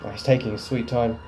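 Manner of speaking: ordinary talk, nothing marked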